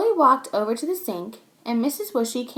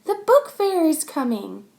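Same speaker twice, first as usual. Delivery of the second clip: higher, louder happy announcement; excited children; 6 semitones higher, 5 dB louder than usual. Acted. Children tell each other excitedly. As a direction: share the thrill.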